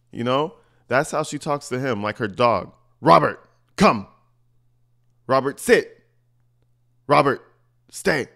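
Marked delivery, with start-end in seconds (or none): 3.0-8.3 imitating her ordering Robert like a dog; barking dog commands. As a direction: bark each command like training a dog. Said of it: "she barks at her boy Robert".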